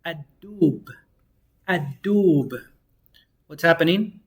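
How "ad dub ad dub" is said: ad-dub with a doubled D, no L; Arabic model word. Shown as a demonstration. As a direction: say ad-dub twice, clean. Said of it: "we have the bear".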